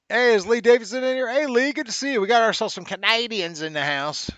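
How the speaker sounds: high, hammed-up Canadian greeting; horrible Canadian accent. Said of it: "That is a horrible Canadian accent".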